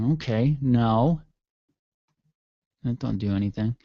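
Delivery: ordinary talk, nothing marked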